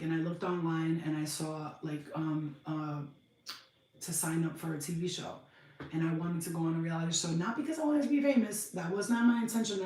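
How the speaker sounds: ordinary talk, nothing marked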